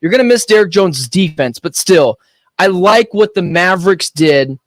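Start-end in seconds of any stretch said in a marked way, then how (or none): none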